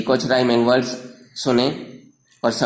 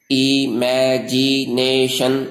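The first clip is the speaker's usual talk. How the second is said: Imagination very slowly in five stretched syllables; much slower than usual. Shown as a demonstration. Teacher shows five tongue movements in imagination, slow syllable by syllable. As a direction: draw out each of the five beats of imagination. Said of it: "पांच हरकतों से अदा होते हैं".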